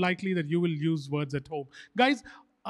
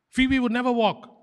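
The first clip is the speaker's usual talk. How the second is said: raised, irritated retort; higher, louder and exasperated; 4 semitones higher, 4 dB louder than usual. Real, not acted. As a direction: let the annoyance break through. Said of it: "I'm getting irritated".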